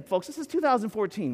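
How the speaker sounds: usual speaking voice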